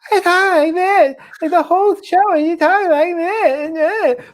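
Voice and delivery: very high, silly dumb-friend character voice. Retold or imitated. Co-host mimicking the dumb friend on Sam and Cat, weird high voice. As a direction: imitate a bizarre, squeaky not-quite-accent, drawn out at the end. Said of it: "she talks with the weirdest voice".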